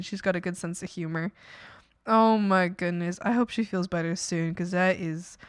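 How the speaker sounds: usual speaking voice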